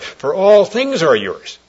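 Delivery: loud, high and slow; preaching climax. Real, not acted. Preacher urging the congregation to thankfulness, loud and slow. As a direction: proclaim each word to the back pew.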